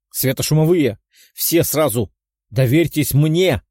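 shouting. Acted. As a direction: bark orders over the gunfire. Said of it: "прокричал Тео".

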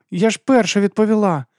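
high-pitched schoolgirl complaint; complaining. A narrator's reading. Abby protesting to the teacher that she was first, complaining. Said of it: "пожалілася Еббі".

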